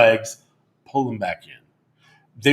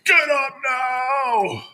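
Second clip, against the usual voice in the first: barked drill sergeant order; 12 semitones higher, much slower than usual. Retold or imitated. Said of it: "You're like a drill sergeant".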